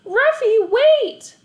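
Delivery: loud, high shout after the dog; boy shouting after his escaping dog; cried out. A narrator's reading. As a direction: yell after the runaway dog. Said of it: "I cried".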